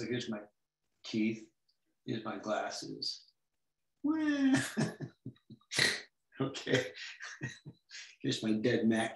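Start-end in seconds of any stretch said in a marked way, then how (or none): 4.0-5.3 high delighted squeal of a laugh; high squeaky laugh. Real, not acted. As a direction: squeak out a laugh at your own joke. Laughs at own drawing.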